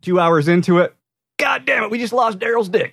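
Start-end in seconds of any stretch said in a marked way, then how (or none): 1.4-2.9 exasperated boss. Retold or imitated. Boss voiced losing worker's dick.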